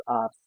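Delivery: Arzt said "arts"; German pronunciation. Shown as a demonstration. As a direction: end Arzt in a hissed s. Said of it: "we pronounce it with S".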